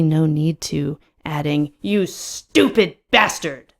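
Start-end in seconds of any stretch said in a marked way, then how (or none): 1.8-3.7 slow, higher-pitched exasperation; drawn-out angry jab; shouted insult. A narrator's reading. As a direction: spit out each word at him. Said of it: "I shout again over the music".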